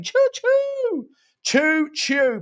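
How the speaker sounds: high, hooted choo-choo; mock train whistle. Streamer celebrates the super sticker train, high mock train whistle. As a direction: hoot it like a steam train.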